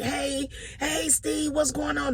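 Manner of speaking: high, slow, bright hello; upbeat greeting. Retold or imitated. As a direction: sing out the daily cheer. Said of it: "this whole upbeat".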